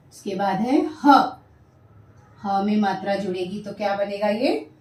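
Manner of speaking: ordinary delivery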